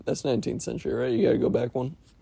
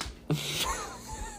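high laugh; 11 dB quieter than usual. Real, not acted. Laughs at own joke.

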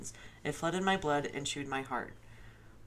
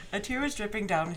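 reading through a laugh, voice raised; struggling not to laugh while reading; breaking into laughter; 10 semitones higher, 16 dB louder than usual. Real, not acted. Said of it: "Hold. Pause".

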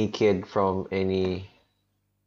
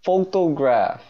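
mock innocent; 14 semitones higher, 5 dB louder than usual. Feigning innocence about the meme photo.

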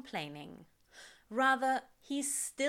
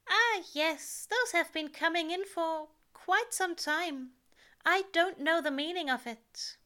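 higher, lightly fussy angel voice; an anxious angel deflecting, pitched up; Aziraphale voice; 9 semitones higher, 5 dB louder than usual. A narrator's reading. Angel answering Crowley about his greys, nervous and higher.

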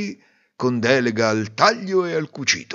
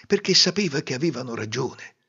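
plain reading voice

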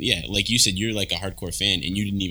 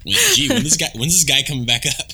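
mock impatience, high and louder; exaggerated higher-pitched grumble; impatient waiting crew; 7 semitones higher, 4 dB louder than usual. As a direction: act out someone fed up with waiting. Acting out the waiting camera crew.

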